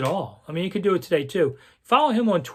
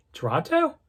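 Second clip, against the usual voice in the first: unsure guess; 11 semitones higher than usual. Retold or imitated. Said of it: "I go".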